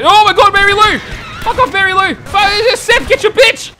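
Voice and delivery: loud, high, frantic shouting; furious panicked yell. Real, not acted. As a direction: yell at the attacker to back off. Attacked by Mary Lou.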